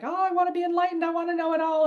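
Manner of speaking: high and fast, a greedy little frog; eager frog voice. Retold or imitated. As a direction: play a frog impatient for enlightenment. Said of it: "the frog that sat there and was like".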